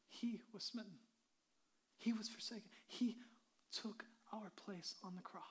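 preacher hushed before the cross; hushed solemn whisper. Real, not acted. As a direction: whisper it, awed. Solemn crucifixion moment.

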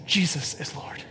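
breathy hushed whisper of the creed; whispered confession. Acted. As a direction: let it fall to a whisper.

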